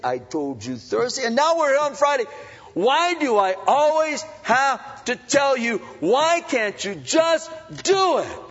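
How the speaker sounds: put-on exasperated parent scolding the kids, rising to a shout; indignant scolding. Dad scolding kids for repeating a mistake, indignant. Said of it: "this kind of indignant, "I can't believe it," condescending attitude".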